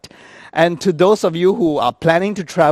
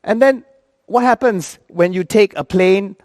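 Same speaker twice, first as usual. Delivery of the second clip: ordinary talk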